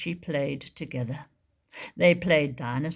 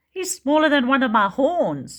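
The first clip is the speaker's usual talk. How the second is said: teasing Triceratops; 7 semitones higher, 6 dB louder than usual. Acted. Triceratops teases Tiny.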